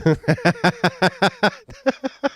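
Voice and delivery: loud, high burst of laughter; real high-pitched cackling laugh. Real, not acted. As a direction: crack up hard at the absurdity. Laughing at own scab joke.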